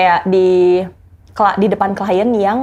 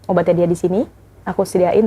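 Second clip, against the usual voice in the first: ordinary talk, nothing marked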